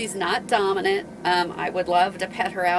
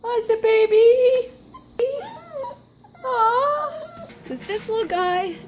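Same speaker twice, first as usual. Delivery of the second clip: much higher, louder, fond coo to the puppy; 5 dB louder, slower than usual. Real, not acted. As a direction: gush over the little dog. Owner coos at small dog.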